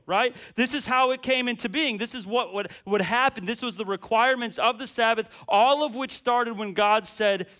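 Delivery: loud, driving sermon delivery; preaching at full force. Acted. Pastor to the church, full-force preaching. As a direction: preach it hard, building the list.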